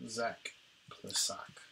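breathy whispered name. Real, not acted. Repeats hard name after remark.

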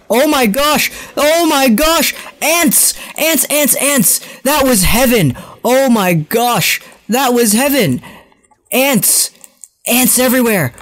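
high, thrilled exclamations; ecstatic. Real, not acted. Gamer celebrates a multi-kill, high ecstatic cries.

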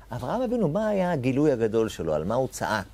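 ordinary talk

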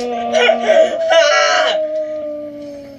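high triumphant cackle; long loud laugh of victory; gleeful loud laugh. Real, not acted. Celebrates setting opponent back.

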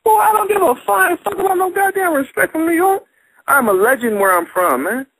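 high, heated outburst; high-pitched, indignant rant. Real, not acted. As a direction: fire it back at them. Heated argument about respect.

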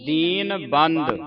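lingering teaching recitation; slow model recitation. Shown as a demonstration. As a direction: stretch both words evenly.

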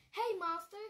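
playful call to the monster. Acted. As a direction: shout for a turn on the swing. Classmates calling to Monster.

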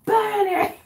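loud high mob cry; mob shout. Retold or imitated. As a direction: shout it like an angry villager. Quoting witch hunt mob.

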